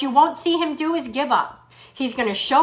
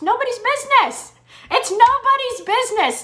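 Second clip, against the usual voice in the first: emphatic insistence; 10 semitones higher than usual. Real, not acted. Insisting the number is private.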